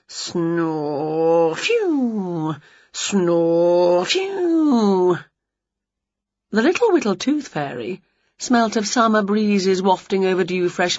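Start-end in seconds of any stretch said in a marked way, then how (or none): 0.1-5.2 snore then a puffed phew; mock snoring breaths. A narrator's reading. Winnie asleep again, mock snore and phew. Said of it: "sank back into snoring".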